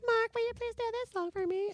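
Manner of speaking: high, soft pleading voice; mock-sweet little request in falsetto; imitating a woman. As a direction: plead softly in a high put-on voice. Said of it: "and she's like".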